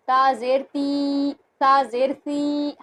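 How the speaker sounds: ता जेर ती spelled out slowly; slow drilled reading. Shown as a demonstration. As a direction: pronounce ता जेर ती, ता जेर सी slowly, syllable by syllable. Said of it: "इस तरीके से नहीं खींचेंगे".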